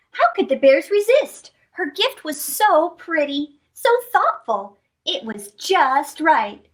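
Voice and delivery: ordinary delivery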